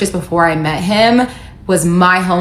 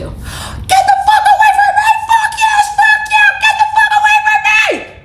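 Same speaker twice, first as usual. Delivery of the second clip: bloody murder scream. Shown as a demonstration. Woman screaming at her stalker ex to get away. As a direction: scream it like you're being kidnapped. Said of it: "I can demonstrate for you".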